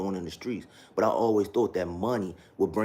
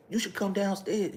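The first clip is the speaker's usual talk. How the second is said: much higher mock pastor voice; imitating father-in-law; 12 semitones higher than usual. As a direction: pitch way up to mimic him. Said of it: "This is how her father talked".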